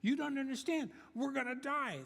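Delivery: high, frantic cry; panicked yelling. Acted. Panicking castaway insisting to his calm friend, frantic. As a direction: plead in terror. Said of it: "One started screaming and yelling".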